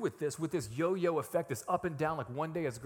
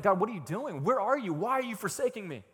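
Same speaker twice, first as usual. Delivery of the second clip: raised, urgent string of questions; complaining to God; 6 semitones higher, 5 dB louder than usual. Acted. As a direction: cry out a complaint in prayer. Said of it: "He brought his complaints before the Lord".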